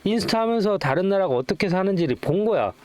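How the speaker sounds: usual speaking voice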